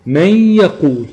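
"من يقول" with a sustained nasal hum between the words; held nasal ghunna. Shown as a demonstration. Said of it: "وبالتالي بتكون الغنة عند الحرف".

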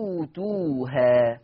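وتوتوها with the hamza turned into a plain long u, low and very slow; ibdal shown. Shown as a demonstration. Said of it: "فتصير الكلمة".

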